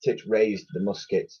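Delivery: ordinary delivery, nothing marked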